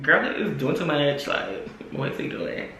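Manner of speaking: low, hushed aside fading to a whisper; retold whispered complaint; aside to friend. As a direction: confide it under your breath to a friend. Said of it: "I'm telling my friend".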